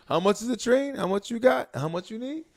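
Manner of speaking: high, rapid playful grilling; teasing interrogation. Retold or imitated. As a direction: corner him with sly questions. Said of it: "Let him do some quick math".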